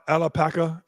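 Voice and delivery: ordinary talk, nothing marked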